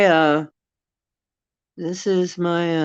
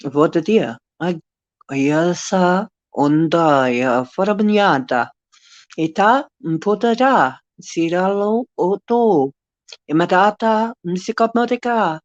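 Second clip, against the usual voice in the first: star-language chant, rising higher phrase by phrase; speaking in tongues; 3 semitones higher than usual. Shown as a demonstration. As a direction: channel an unknown ancient-sounding language. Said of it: "oh, I'll do some of it".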